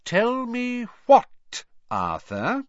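slow, high, loud warning to a husband; dangerous. A narrator's reading. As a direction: demand it slowly and menacingly, pitched high. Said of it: "in a dangerous sort of voice".

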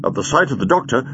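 plain reading voice

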